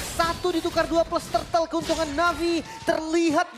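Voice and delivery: high, loud, hyped play-by-play; excited esports caster. Real, not acted. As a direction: call the fight at full hype. Caster calls a big team fight. (komentator esports yang bersemangat)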